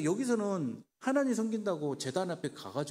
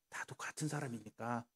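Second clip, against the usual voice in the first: put-on coaxing whisper as the old prophet; old prophet whispering to win his trust; 4 semitones lower, 8 dB quieter than usual. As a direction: lure him in with a whisper.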